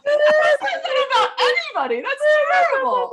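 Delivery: high, loud shrieking laughter; real laughing outcry; laughing protest. Real, not acted. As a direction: protest loudly while cracking up. Mother protests daughter's crying impression.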